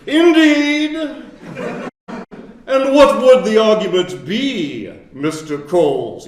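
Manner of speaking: high, loud, slow imitated professor; professor's booming reply. The professor calling on the student, booming imitation. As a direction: boom it out like a grand old professor. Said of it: "he goes".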